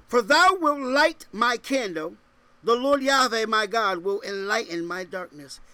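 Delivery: lifted voice for the verse; scripture proclamation. Real, not acted. Preacher reads scripture to congregation, proclaiming it. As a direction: proclaim it strongly, then ease slightly.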